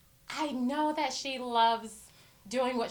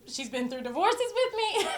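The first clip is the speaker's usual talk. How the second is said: higher pitched, breaking into a laugh; laughing fondly; 7 semitones higher than usual. Real, not acted. Host on a longtime friend, laughing through it. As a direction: let a laugh spill out as you say it.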